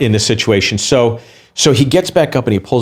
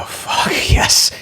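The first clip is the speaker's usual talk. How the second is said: whispered yes. Retold or imitated. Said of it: "I go".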